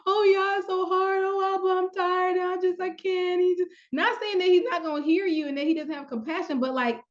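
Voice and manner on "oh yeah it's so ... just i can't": higher, whiny mock complaining; mock whining. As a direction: play the tired complainer, wheedling. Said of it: "you be like".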